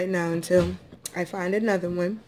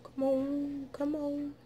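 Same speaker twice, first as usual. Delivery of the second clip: soft, higher coaxing murmur; 8 semitones higher, 9 dB quieter, slower than usual. Real, not acted. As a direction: sweet-talk the shell to open. Coaxing crab shell open.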